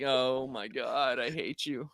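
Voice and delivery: slow, quiet exasperation; deadpan disgust. Retold or imitated. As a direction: mutter your disgust slowly. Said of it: "And I'm like".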